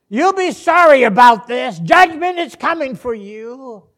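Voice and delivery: loud, high-pitched threat of judgment; vengeful warning. Acted. Preacher voices words Jesus never said, vengeful warning. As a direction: shout down at them in warning. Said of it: "And his first words were".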